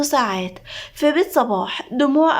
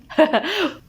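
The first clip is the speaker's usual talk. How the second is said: short higher-pitched giggle; amused laugh; 5 semitones higher than usual. Acted. Nada mocks the beaten manager, amused laugh. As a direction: break into a brief chuckle.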